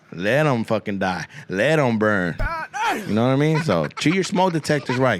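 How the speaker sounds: ordinary talk, nothing marked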